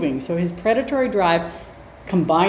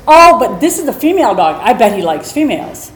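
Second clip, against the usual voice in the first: higher, louder insisting; imitating pushy woman; 4 semitones higher, 8 dB louder than usual. Storyteller mimicking the woman insisting on the meeting, louder. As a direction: push it like she won't take no. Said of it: "And she said".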